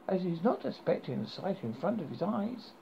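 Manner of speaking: ordinary delivery, nothing marked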